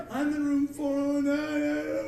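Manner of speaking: high, slow singsong wail; loud, drawn-out child's lament; lost little kid. Acted. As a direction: drag it out like a helpless child. Lost kid wails his room number.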